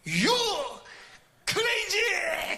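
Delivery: high shouted insult; umpire insulting. Retold or imitated. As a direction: fling it at him. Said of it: "그런 거야".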